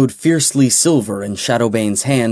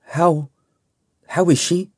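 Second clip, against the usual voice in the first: fearful; 6 semitones higher than usual. Acted. Dreading news of injured friend.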